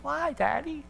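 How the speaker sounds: put-on high, soft little kid asking Dad; little boy's voice. Little son asks his dad.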